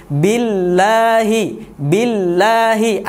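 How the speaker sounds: billahi with long doubled l pressed; slow billahi, lean on the ll; pressed shadda. Shown as a demonstration. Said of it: "bacanya ... ditekan".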